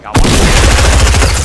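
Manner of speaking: loud triumphant shout; excited shout. Real, not acted. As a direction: yell it out in triumph. Just got a kill.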